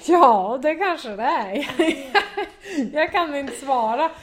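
laughing between the phrases; amused laughter. Real, not acted. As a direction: answer loudly through real laughter. Amused by dialect question.

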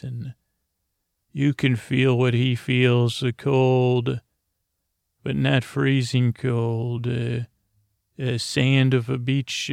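ordinary delivery, nothing marked

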